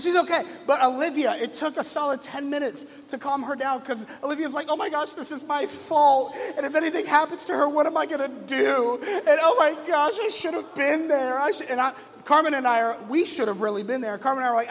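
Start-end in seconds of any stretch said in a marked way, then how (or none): 4.7-11.7 put-on higher, fretful girl voice with a touch of panic; a hint of a guilty ten-year-old; upset big sister. Daughter blaming herself, upset.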